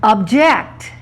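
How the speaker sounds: "Object" stressed on the second syllable; "Object" as a verb, end-stressed. Shown as a demonstration. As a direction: say "Object" as ob-JECT. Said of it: "I'm gonna reverse it on you".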